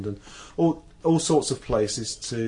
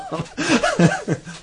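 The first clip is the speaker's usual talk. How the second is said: laugh; 8 dB louder than usual. Real, not acted. Laughing before doing an impression.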